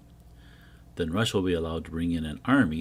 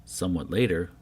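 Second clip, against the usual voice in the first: normal talking voice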